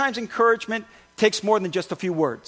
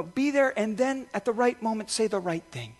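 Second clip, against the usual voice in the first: ordinary delivery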